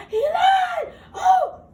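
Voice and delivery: very high, loud shocked cry; shocked outcry. Acted. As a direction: shriek it out in disbelief. Fairy exclaims at the lie.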